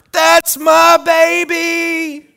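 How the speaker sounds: high, loud, slow holler; proud holler. Retold or imitated. Parent in the bleachers cheering his kid, loud proud holler. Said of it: "and I holler".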